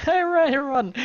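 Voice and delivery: loud, very high yelp; mock-panicked high shout; panicked outburst. Traveler flees the gang, loud mock panic. Reader bursts out at choice to flee.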